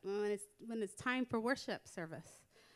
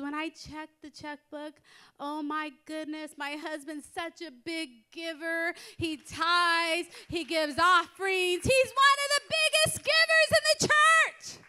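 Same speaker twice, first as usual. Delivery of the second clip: excitement building to a high, loud shout; imitated proud wife, louder with every phrase; excited boasting; 5 semitones higher, 9 dB louder than usual. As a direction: gush as the relieved wife, climbing to a squeal. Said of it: "I'm so happy".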